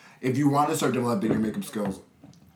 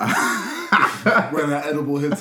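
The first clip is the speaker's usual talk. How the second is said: stoned; 2 semitones higher, 5 dB louder, much slower than usual. Pretend. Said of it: "edible joke, drawn out".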